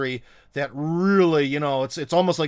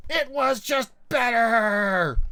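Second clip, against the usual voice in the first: slow, loud, higher mocking insistence; mock stubborn shout; 7 semitones higher, 3 dB louder, much slower than usual. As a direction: drag it out like a stubborn nostalgic commenter. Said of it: "things they always say about communism".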